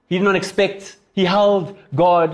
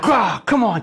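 higher retold growl of frustration; mock-angry exasperated outburst; 4 semitones higher, slower than usual. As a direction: act out Daniel losing his temper. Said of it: "He's angry".

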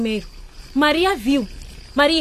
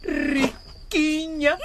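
slow drawn-out taunt, laughing; mocking laugh; 7 semitones higher, much slower than usual. Boy teases the girl about her rich house, laughing. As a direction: stretch the word out to mock her.

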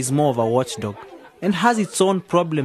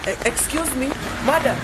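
high call to catch her attention; 8 semitones higher than usual. Acted. To the lady at her car, calling out. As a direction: stop her before she drives off.

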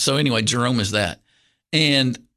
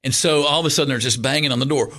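ordinary delivery

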